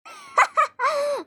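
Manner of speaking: squealing high laugh that can't be held back; loud high giggle. Acted. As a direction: break into a high helpless laugh. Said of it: "es que me dio risa".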